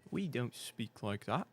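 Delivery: slow, soft mock British accent. Streamer still teasing British speech, soft mock British. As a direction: murmur it slowly in put-on British. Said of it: "make fun of us for speaking like that".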